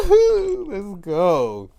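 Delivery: high, loud whoop; high whoop, then let's go; whooping cheer. Retold or imitated. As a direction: cheer on the fight. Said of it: "I was like".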